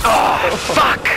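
high, loud angry curse; raging gamer. Real, not acted. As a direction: yell it out in frustration. Final death, raging.

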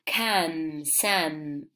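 "Come" and "some" both said with a short open kam, sam vowel; "Come, some" with the same short vowel, final E not sounded; rhyming pronunciation. Shown as a demonstration. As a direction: say "Come" then "some" so they rhyme, silent E. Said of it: "se pronuncian parecido".

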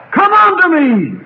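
high, loud preacher's call; ringing shout of invitation; proclaimed cry. Retold or imitated. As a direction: cry it out to the multitudes. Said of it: "have heard the cry".

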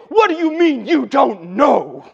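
high, loud, drawn-out scolding; exasperated parent. Acted. Parents asking a child for reasons, exasperated scolding. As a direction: demand it slowly at a near shout.

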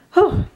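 high, loud whew; excited exhale. Real, not acted. Fan lets out her excitement with a high whew. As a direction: blow out the excitement.

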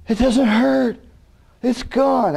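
amazed relief. Retold or imitated. Preacher voices the healed woman crying out in amazement. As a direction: cry out in amazement at the pain vanishing.

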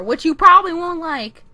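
sudden loud, high jab; loud, playful grievance; mock aggrieved outburst. Mock complaint to the character.

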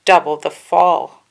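loud, slow villain taunt; Dooku taunting. A narrator's reading. Count Dooku taunts Anakin, slow and loud. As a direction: savor the threat slowly. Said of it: "Count Dooku said".

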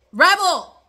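loud, high call to the dog; sharp call across the room to stop him. Real, not acted. Podcaster calls her dog off the plant, loud and high.

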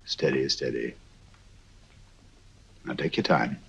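low and soft, calming her; soothing. Acted. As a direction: settle her gently. Calming the upset girl.

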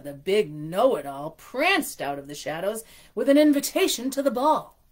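storybook reading voice; story narration. A narrator's reading. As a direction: read it like a bedtime story. Reads picture book aloud.

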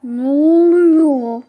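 loud, drawn-out yell at the game; frustrated shout. Real, not acted. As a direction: bellow it out in disbelief. Oyunda telefon takılıyor, bağırıyor. (oyuna öfkeyle uzun bağırış)

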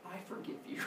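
hushed, almost voiceless acceptance; awed forgiveness. Acted. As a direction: barely get it out, instantly won over. Acted awestruck forgiveness after the offer.

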